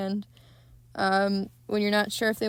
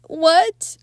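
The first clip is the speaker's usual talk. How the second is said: high, loud, incredulous what; shocked what; 7 semitones higher, 8 dB louder than usual. Real, not acted. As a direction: blurt it out in real disbelief. Shocked by the priest's question.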